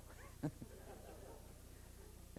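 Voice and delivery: soft breathy laugh. Real, not acted. Laughs after telling strange event.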